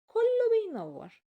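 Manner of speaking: high, loud and slow in wonder; awed. A narrator's reading. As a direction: stretch it out in amazement. Narrator marvels at shining clothes.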